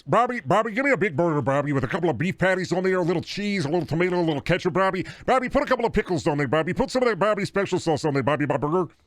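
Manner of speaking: put-on voice nagging for a burger order; pestering burger customer. Hungry customer badgers Bobby for a burger, pestering.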